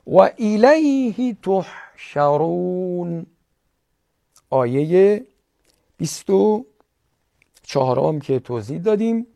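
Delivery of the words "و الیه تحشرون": و الیه تحشرون with pure i and u, slow; Arabic vowels recital. Shown as a demonstration. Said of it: "به همین ترتیب". (تلاوت با حرکات عربی)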